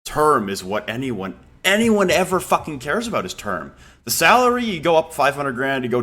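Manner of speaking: heated emphasis. Real, not acted. Arguing term matters most.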